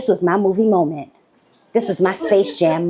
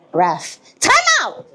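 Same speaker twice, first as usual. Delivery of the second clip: high, slow call to the ref; hammed-up coach calling time; smug timeout call; 11 semitones higher, slower than usual.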